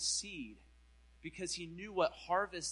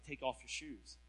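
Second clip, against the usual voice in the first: soft voice of God; 5 semitones lower, 11 dB quieter than usual. Retold or imitated. God speaking quietly.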